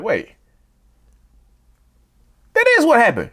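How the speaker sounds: high indignant protest; pleading protest. Retold or imitated. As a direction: insist on it in disbelief. Voicing the confused victim.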